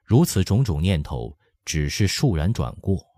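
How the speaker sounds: plain reading voice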